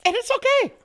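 very high, loud fake-brave cry; mock distress. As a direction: pretend to be falling apart while insisting otherwise. Mock sobbing over cancelled film.

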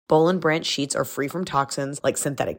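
upbeat sponsor read. Acted. Podcast host reading sponsor ads, upbeat and smooth. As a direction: sell it like a podcast ad.

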